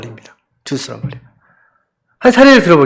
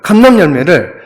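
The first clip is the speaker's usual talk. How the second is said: ordinary talk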